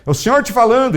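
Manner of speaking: high, loud emphatic call; prophetic call. Retold or imitated. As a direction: proclaim it like a prophet. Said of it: "Ele falava de vez em quando".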